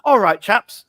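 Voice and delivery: hammed-up English toff accent, high and loud; Bertie Wooster English accent. Resistance lady switching to English, Bertie Wooster accent. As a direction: imitate a chipper, caricatured upper-class English chap. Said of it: "she talks like Bertie Wooster".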